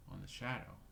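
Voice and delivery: plain reading voice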